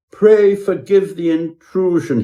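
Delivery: high, whining plea from a frightened guest; timid houseguest whining an apology. A narrator's reading. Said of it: "he whined".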